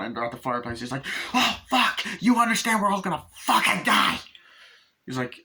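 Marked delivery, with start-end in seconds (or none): 1.3-4.2 much higher and louder, panicked screaming; panicked yell. Retold or imitated. Father at the fireplace screaming at family, panicked yell. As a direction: shriek that everyone is doomed.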